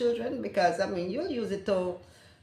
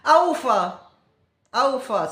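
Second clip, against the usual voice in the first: "A oofa" louder, punchy patois demo; Jamaican patois; 4 semitones higher, 8 dB louder than usual. Shown as a demonstration. Teaching how to ask whose, loud patois. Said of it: "ask them a oofa".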